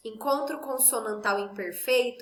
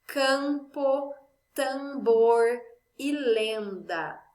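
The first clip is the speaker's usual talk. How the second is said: lenda bem lenta, sílabas marcadas; dito devagar; much slower than usual. Shown as a demonstration. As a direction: arraste lenda para as crianças ouvirem. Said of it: "Por exemplo". (exemplo falado devagar para a turma)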